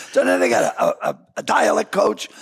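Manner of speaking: normal talking voice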